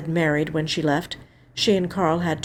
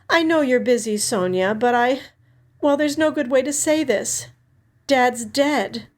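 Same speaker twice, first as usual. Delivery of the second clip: put-on older sister Alex, gently breaking news; 7 semitones higher than usual. A narrator's reading. Sister on the phone telling Sonny their dad died, raised and sad.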